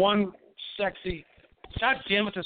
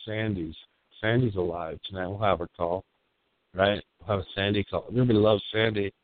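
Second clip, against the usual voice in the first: low magnetic voice; 10 semitones lower, slower than usual. Acted. Late-night radio persona.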